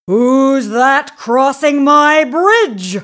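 loud, slow, drawn-out troll roar. A narrator's reading. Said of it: "roared the big, hairy troll".

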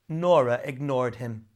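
usual speaking voice

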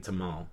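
TAMAL as tuh-MAHL; heard pronunciation. Shown as a demonstration. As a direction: say tamal with "ah", two syllables. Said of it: "only way that I've really heard it pronounced".

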